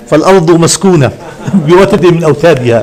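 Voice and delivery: put-on the Arabic verse in a laughing English accent; mock English accent. Teasing language teachers with an English-accented line, laughing. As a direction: read فالأرض مسكونة like an English speaker, laughing. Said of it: "إذا تكلمت إنجليزي انتبه".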